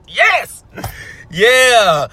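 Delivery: a sudden high cheer of joy; excited shout. Real, not acted. Vlogger cheering to viewers about the comedy show, excited shout. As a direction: shout yes like you just won.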